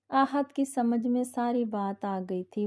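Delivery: plain reading voice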